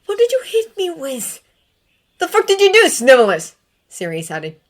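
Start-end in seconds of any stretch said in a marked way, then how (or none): 0.1-1.4 put-on high, pained young man; James voice. 2.2-3.5 loud, high furious yell; angry shout. Acted. Sirius yells at Snape.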